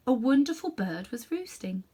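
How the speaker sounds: plain reading voice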